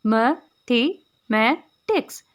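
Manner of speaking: Mathematics in four separate syllables, pausing after each. Shown as a demonstration. Teacher showing four syllables, mathematics said slowly in separated pieces. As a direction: slowly count out mathematics beat by beat. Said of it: "यानी four syllables हमारे पास आ गए".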